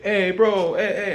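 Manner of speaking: imitated street tout, high and loud; mock hustler's loud hail. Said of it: "this guy would come up".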